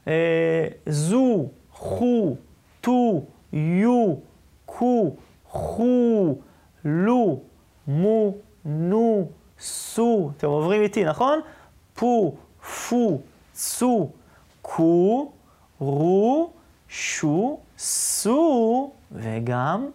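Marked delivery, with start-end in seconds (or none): 0.9-10.3 long, drawn-out oo on each syllable; slow teacher's reading drill. Shown as a demonstration. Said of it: "את הצליל הזה". 11.9-18.9 long, drawn-out oo on each syllable; slow teacher's reading drill. Shown as a demonstration. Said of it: "את הצליל הזה".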